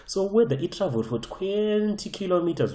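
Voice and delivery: "twenty" stretched out long and high; exaggerated stress. Real, not acted. Teacher dismissing distance along the slope, exaggerated stress. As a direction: draw out "twenty" to make the distance sound huge.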